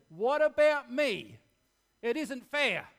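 put-on loud, high childish whine; whining complaint. Preacher acting out the selfish whine, loud and high. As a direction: wail it like a spoilt child.

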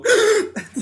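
mock startled gasp, high and loud; imitating the mom's habitual gasp in the car; sharp gasp. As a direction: gasp like you just saw a squirrel on the road. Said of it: "she'll go".

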